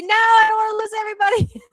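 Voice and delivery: high, fast panicky voice; panicked. Real, not acted. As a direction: squeak it out in a panic. Panicked streamer, voice shot up.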